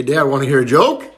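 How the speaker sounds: normal talking voice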